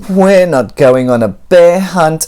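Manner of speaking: ordinary delivery, nothing marked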